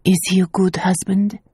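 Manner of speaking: hushed private whisper. A narrator's reading. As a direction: ask it secretly in a whisper. Mother asks privately.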